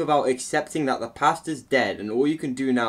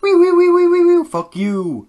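tiny high-pitched robot squeal, much higher and louder; squeaky robot; 13 dB louder than usual. Retold or imitated. Reviewer mocking the egg guardian robot, high loud squeaks. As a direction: chirp like a silly little machine. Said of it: "the robot's like".

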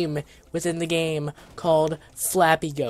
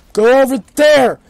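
higher, loud command; loud shout; 5 semitones higher, 11 dB louder than usual. Real, not acted. Gamer ordering his goat away, loud shout. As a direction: bark it across the field.

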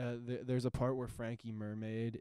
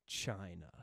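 deadpan punchline; 4 semitones lower than usual. Retold or imitated. Deadpan punchline quoting the movie.

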